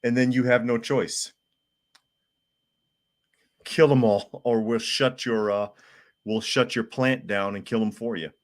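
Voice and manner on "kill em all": ordinary talk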